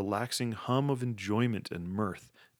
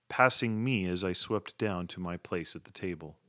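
usual speaking voice

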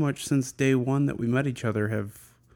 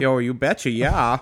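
imitating the You Betcha guy, Minnesota accent; light Minnesota impression; 4 dB louder than usual. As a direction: Minnesota accent, a touch louder. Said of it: "Why are you doing a Minnesota accent".

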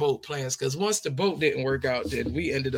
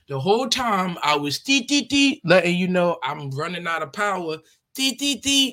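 beeping; 3 semitones higher than usual. Retold or imitated. Imitating laptop warning beeps.

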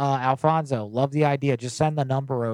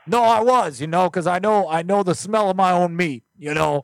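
high, loud, pestering caller voice; loud prank caller; 7 semitones higher, 7 dB louder than usual. Acted. Prank caller pleading for a lost meat wad, high and loud. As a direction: play an upset caller, pitched up and loud.

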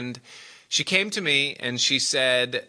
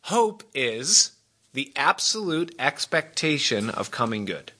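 ordinary delivery

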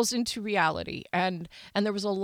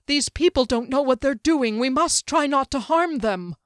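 louder, higher pleading command; pressing, raised-voice appeal; urgent plea; 5 semitones higher, 5 dB louder than usual. Acted. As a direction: urge restraint over the noise of a mob. Mage urging company amid mob attack.

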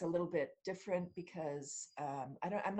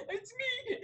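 high-pitched, giggling admission of guilt; laughing confession. Retold or imitated. Confessing to the boss that she broke the door, laughing high. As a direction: own up through bursts of laughter.

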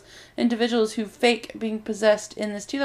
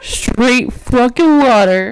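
drawn-out loud bro yell; loud slow bro voice; 11 dB louder, slower than usual. Pretend. Slow loud meme repeat.

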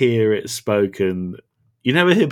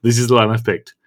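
ordinary talk

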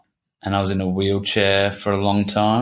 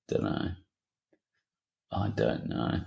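whispered uncertainty; 13 semitones lower, 11 dB quieter than usual. Real, not acted. Unsure about turning point.

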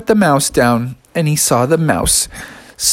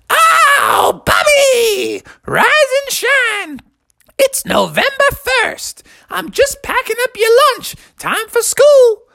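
very high, sing-song mother, slow and sweet; cheery mom voice; slower than usual. Acted. Mother waking Bubby for school, very high and chirpy. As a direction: wake a child like a chirpy mom.

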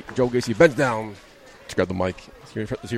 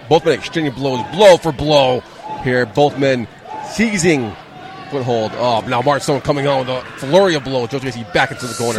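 live ringside play-by-play; wrestling commentator. Acted. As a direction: call the match as it happens.

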